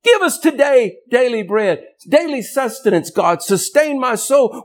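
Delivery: high-pitched, desperate plea to God; begging. Acted. Preacher begging God for daily bread, high desperate plea. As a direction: plead like a starving soul. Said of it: "begging at the gate like a, like a starving soul".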